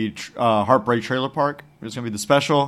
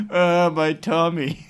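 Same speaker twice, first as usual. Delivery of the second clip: whiny tummy ache; 3 semitones higher, much slower than usual. Retold or imitated. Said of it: "you're like".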